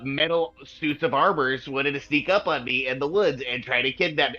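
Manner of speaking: high-pitched, sarcastic goblin voice; squeaky, exasperated self-justification; indignant goblin. Acted. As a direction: defend yourself as a put-upon little goblin. Game master plays goblin Droop.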